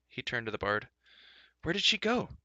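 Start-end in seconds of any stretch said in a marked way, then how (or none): 1.6-2.4 high, alarmed question. A narrator's reading. Terran asking the bard in alarm, high. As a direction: ask in sudden alarm. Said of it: "he asked in alarm".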